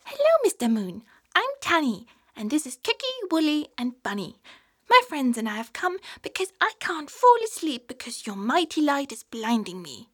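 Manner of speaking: put-on high, eager child's voice pleading; a little cub asking politely for help; young polar bear. Polar bear Tanny explains.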